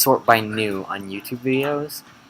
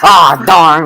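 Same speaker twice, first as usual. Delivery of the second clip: loud mock dismay; 6 semitones higher, 16 dB louder than usual.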